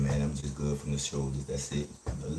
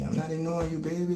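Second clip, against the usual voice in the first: much higher, drawn-up reassurance; high-pitched protest; 12 semitones higher, faster than usual. Real, not acted. Streamer answers a viewer who asked why ignore me, high-pitched protest.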